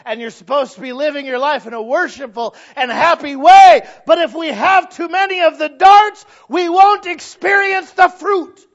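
building from firm to a high, very loud shout; full-force pulpit crescendo; fervent preaching. Real, not acted.

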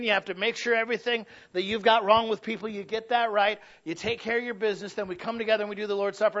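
ordinary talk

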